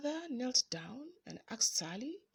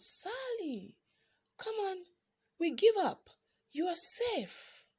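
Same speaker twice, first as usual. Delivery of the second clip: high coaxing call; 7 semitones higher than usual. A narrator's reading. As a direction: call out to coax her from hiding. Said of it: "they called out".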